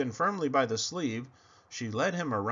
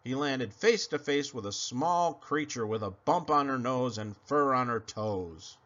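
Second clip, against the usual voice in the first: ordinary delivery, nothing marked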